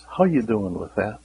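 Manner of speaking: ordinary talk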